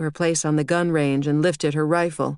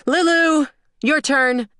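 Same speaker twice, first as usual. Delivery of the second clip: high, loud call to sister; 10 semitones higher, 5 dB louder, slower than usual. A narrator's reading. Daughter calls her little sister to the phone, high loud call. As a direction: yell for Lulu to come.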